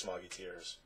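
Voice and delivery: plain reading voice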